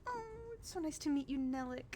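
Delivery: high, bubbly noble lady; cheerful Adela. Acted. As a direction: chirp it up like an eager hostess. Adela greets.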